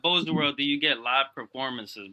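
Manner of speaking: loud, pumped-up host hype; booming hype-man call; hype shout. Real, not acted. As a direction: shout it out like a stream host hyping the crowd. Hyping the live performance.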